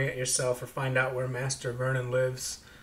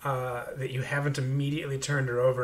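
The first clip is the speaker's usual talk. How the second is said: ordinary talk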